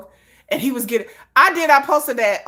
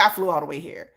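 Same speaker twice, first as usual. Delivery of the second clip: lower, softer line for herself; 6 semitones lower, 4 dB quieter, faster than usual. Retold or imitated. Mock comparison, the me line said lower and softer. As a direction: sink down for the me part. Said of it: "It's like".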